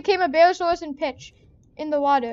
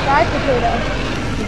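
low slow growling die potato; slow menacing monster growl; 4 semitones lower, 4 dB louder, much slower than usual. Pretend. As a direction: growl it like a hungry dinosaur. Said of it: "What'd you say?".

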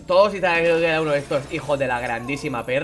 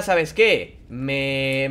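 usual speaking voice

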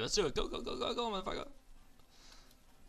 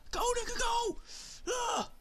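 loud, high hype shouting; excited yelling; 13 semitones higher, 10 dB louder than usual. Real, not acted. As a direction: fire everyone up to charge. Rushing teammates in game.

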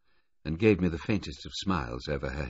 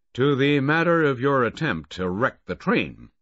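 more loudly; 9 semitones higher, 8 dB louder than usual. A narrator's reading. Bill Baudelaire calling the inquiry back to order, louder. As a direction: call the room back to order. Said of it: "said more loudly".

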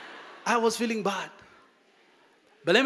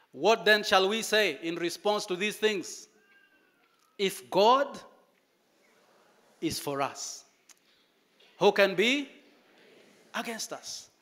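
scripture reading. Acted.

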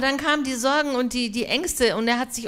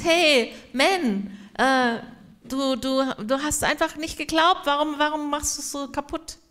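casual Jesus; 5 semitones higher than usual. Retold or imitated. Interpreter mimics casual Jesus.

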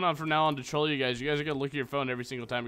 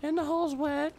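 silly high voice; 11 semitones higher than usual. Pretend. Cracking a dirty joke to viewers, in a silly high voice. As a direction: play it as a cheeky punchline. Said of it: "God, I am so humorous".

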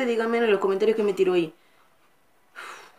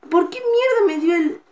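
much higher, louder angry cry; furious gamer outburst; outraged outburst; 9 semitones higher, 4 dB louder than usual. Real, not acted. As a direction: spit out mierda in frustration. Outburst at game.

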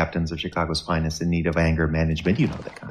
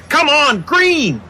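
much higher and louder, yelling at the light; impatient yell; 5 dB louder, slower than usual. Acted. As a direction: shout the red light into changing. Impatient at stoplight, late for appointment.

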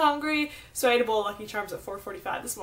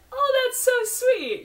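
much higher, slow, gushing oh that's so sweet; 12 semitones higher, 4 dB louder, slower than usual. Shown as a demonstration. What she wants people to say instead, high sweet gush. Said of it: "just be like".

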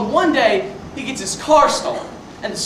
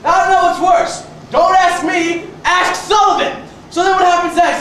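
usual speaking voice

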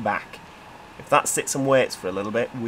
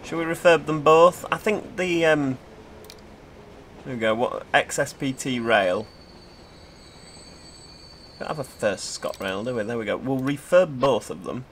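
ordinary talk

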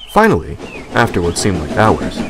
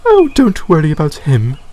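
higher, louder soothing coach; Clarabelle voiced; 7 semitones higher, 5 dB louder than usual. A narrator's reading. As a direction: reassure the newcomer. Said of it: "Clarabelle replied".

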